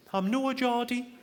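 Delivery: high, drawn-out mock Sunderland accent; terrible Sunderland accent. Barmaid objects to being called Geordie, bad Sunderland accent. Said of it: "that's a terrible accent".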